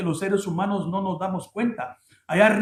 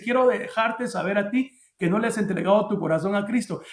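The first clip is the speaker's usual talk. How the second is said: ordinary delivery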